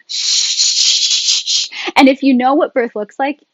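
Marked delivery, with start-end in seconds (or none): 0.1-1.9 long breathy shushes; drawn-out imitation of labouring shushes; acted shushing. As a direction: hush him with repeated shh. Acting woman shushing in labour.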